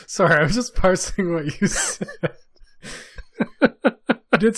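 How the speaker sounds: high, loud, laughing through the words. Real, not acted. Cohost cracking up over "country" Quebec, laughing. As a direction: struggle to talk through real laughter.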